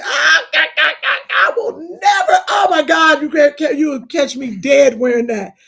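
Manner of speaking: loud, laughing mock disgust at aunties' shoes; retelling teasing aunties, loud mocking laugh and yell; mock laughing scorn. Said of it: "We used to be like".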